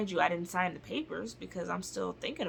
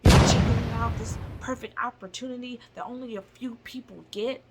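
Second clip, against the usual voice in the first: mock pushy salesman; 4 semitones higher, 9 dB louder than usual. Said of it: "he was like".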